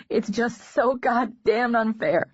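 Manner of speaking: a raw cry against the unfairness; anguished protest. Acted. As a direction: drag out each word in bitter grief. Hazel's outburst at his news.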